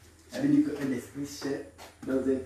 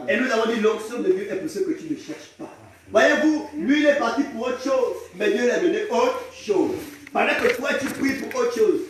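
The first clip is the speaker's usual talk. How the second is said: loud, emphatic pulpit delivery; raised, declaring preacher's voice; forceful preaching; 6 semitones higher, 6 dB louder than usual. Real, not acted. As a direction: press the point on the congregation.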